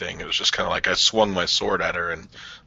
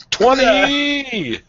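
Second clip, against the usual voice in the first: excited roll call; 5 dB louder, much slower than usual. Real, not acted. Rolling damage, excited.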